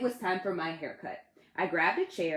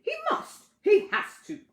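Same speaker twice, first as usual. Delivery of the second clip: Ted gasping; 6 dB louder than usual. Acted. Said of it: "Ted gasped".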